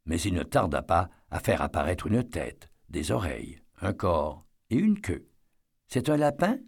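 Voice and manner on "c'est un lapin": voix de fillette. A narrator's reading. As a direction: question de petite fille, plus aiguë. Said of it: "demanda la fillette". (voix aiguë de fillette)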